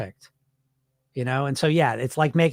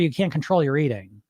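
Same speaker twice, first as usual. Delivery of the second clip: ordinary talk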